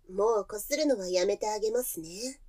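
usual speaking voice

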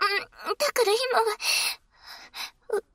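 usual speaking voice

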